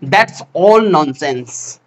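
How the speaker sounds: very slow, word by word; drawn out for learners to repeat; slow teaching read. Shown as a demonstration. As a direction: spell it out slowly for the class. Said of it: "কি হলো".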